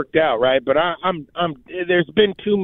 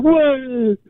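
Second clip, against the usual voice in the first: retold scream; 10 semitones higher, much slower than usual. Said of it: "I just start screaming".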